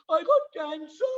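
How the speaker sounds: high-pitched put-on tearful wail; hammed-up sobbing confession; mock crying. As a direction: imitate a man wailing his bad news. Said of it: "one man started crying".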